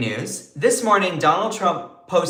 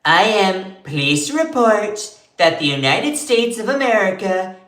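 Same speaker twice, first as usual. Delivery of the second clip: higher, louder, pompous presidential read; Trump impression; 4 semitones higher, 4 dB louder than usual. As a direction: puff up and proclaim like Trump. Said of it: "I can't do my Trump impression".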